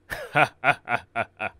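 hearty kingly chuckle of approval; pleased royal laugh. Acted. King laughing, pleased with his daughter's suitor.

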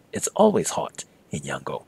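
ordinary talk, nothing marked

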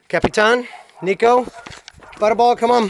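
high, loud holler; calling the dogs. Real, not acted. Owner calling the dogs to come on, high shouted call. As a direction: shout each name out across the yard.

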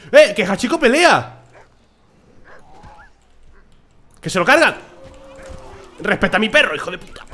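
loud high cheering at the screen; hype yelling. Real, not acted. Gamer cheering on his dog, loud and high. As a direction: yell at the game as your dog fights.

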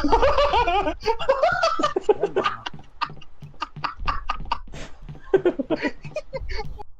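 high-pitched laughing fit; long laugh. Real, not acted. Player cracking up after the scare upstairs, long high laugh. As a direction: laugh hard, high, trailing off. Reacting to scare in horror game.

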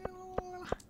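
high, hushed and slow, spooked; scared. Pretend. Player spotting mine monsters, hushed and frightened. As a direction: shrink back in a small frightened voice.

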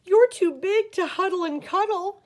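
a lightly voiced complaining boy; dismayed little boy. A narrator's reading. Eddie to the oversized teddy, mildly dismayed.

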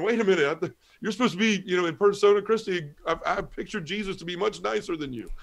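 high-pitched, affronted comeback; protesting, voice pushed up high; indignant protest. Retold or imitated. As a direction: retell a flustered objection to an angry priest. Acted protest at yelling priest.

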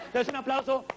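high-pitched pushy party host; hammed-up high party emcee ordering everyone to dance; party animator. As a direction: boss the crowd around like a cheesy animator.

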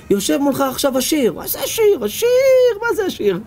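high, scoffing "what is rich?"; mocking disbelief.

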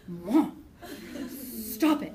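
loud, half-laughing protest; mock mortified plea. Mortified daughter to drugged mom at the doctor's, loud embarrassed plea. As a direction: imitate an embarrassed daughter begging.

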